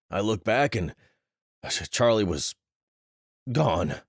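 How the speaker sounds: voice cracking. Acted. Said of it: "His voice cracked on the last word".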